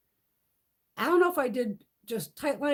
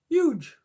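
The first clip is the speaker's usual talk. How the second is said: HUGE punched high and louder; emphatic repeat; 5 semitones higher, 6 dB louder than usual. Real, not acted. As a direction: hit Huge hard for emphasis. Emphasising the cat's size.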